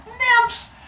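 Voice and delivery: very high squeaky cry; cartoonish high outburst; blurted exclamation. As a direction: pitch one word way up. Woman blurts out explanation.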